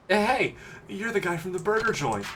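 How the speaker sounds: put-on high boyish game character; eager teenage boy, recognizing someone. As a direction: play a kid calling out in recognition. Voices the kid in game.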